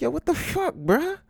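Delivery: very high incredulous question; high-pitched, exasperated reaction; inner outrage. Retold or imitated. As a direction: squeal it in appalled disbelief. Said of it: "I'm like, in my mind".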